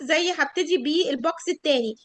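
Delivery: ordinary talk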